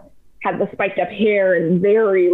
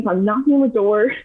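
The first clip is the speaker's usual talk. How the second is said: normal talking voice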